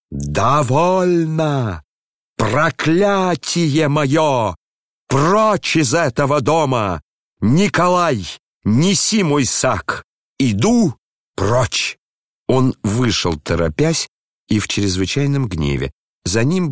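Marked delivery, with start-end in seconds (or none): 0.1-12.0 высоко, медленно, протяжно кричит; проклятие старика, растянуто и высоко; проклинающий крик. A narrator's reading. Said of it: "поднял руки и прокричал". (медленный высокий крик проклятия)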